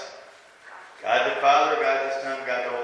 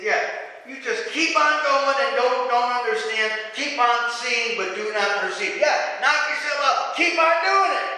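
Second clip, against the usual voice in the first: mock encouragement, pitched up and rising louder; sarcastic; 6 semitones higher, 6 dB louder than usual. Preacher voices God telling stubborn people to carry on, sarcastically. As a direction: goad them on with high, loud sarcasm. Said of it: "He was being sarcastic".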